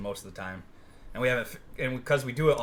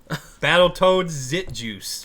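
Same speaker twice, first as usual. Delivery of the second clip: slow, drawn-out dramatic reveal; 4 semitones higher, 8 dB louder, much slower than usual. Acted. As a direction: stretch the name out slowly.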